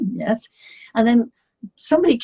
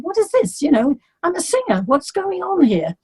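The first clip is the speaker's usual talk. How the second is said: higher, baffled inner voice; bewildered; 8 semitones higher than usual. Retold or imitated. Singer baffled at the hostile crowd, higher and bewildered. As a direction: ask it in disbelief. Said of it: "I thought".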